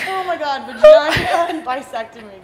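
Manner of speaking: laughing. Real, not acted. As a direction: burst out laughing at the silly names. Laughing at the word mix-up.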